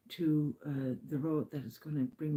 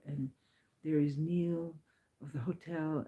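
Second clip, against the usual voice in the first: usual speaking voice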